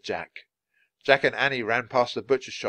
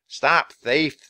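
loud, slightly raised shout; yelling; 4 semitones higher, 6 dB louder than usual. Acted. Baker joining the chase, yelling the alarm. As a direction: call the alarm out loudly. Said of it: "the baker yelled".

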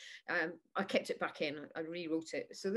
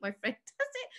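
normal talking voice

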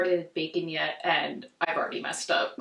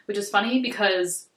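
normal talking voice

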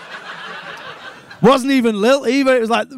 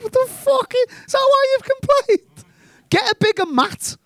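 very high-pitched mock disbelief; high, exasperated comeback; incredulous retort; 14 semitones higher than usual. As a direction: squeak it out in baffled protest. Acts out baffled retort to complaint.

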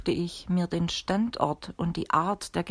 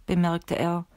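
usual speaking voice

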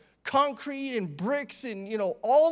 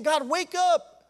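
urgent plea; 6 semitones higher, 4 dB louder, slower than usual. Acted. We're urging the Lord.

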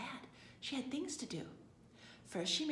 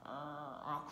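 low sleepy snore; deep, rumbling fake snore; 6 semitones lower than usual. A narrator's reading. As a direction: snore like a slumbering bear. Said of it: "snored Mister Bear".

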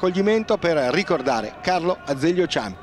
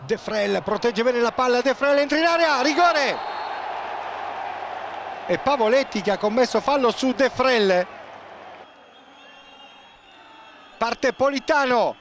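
rising live goal call; breathless italian goal commentary; 3 semitones higher than usual. Acted.

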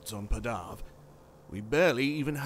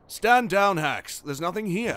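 raised, louder order; commanding; 6 semitones higher, 6 dB louder, slower than usual. A narrator's reading. Commander orders the AI to stand down, commanding. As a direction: call it off firmly.

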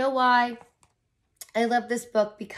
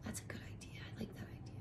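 whisper; 20 dB quieter, faster than usual. Real, not acted. Reader's aside to listeners.

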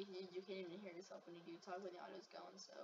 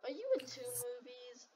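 calling out; 3 semitones higher, 10 dB louder than usual. Real, not acted. Calling to animatronic character.

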